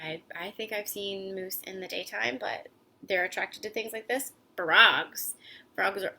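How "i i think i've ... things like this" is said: whispered aside. Real, not acted. Aside to kids while reading.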